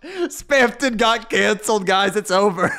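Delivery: talking through real laughter; laughing. Real, not acted. Streamer telling guys Spamton got canceled, laughing. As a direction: announce it while cracking up. Joking announcement while cracking up.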